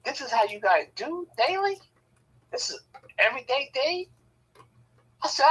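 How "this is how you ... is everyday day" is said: high, rising incredulous questions; acted disbelief. Visitor shocked at how the couples talk, incredulous. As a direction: question them in high-pitched astonishment.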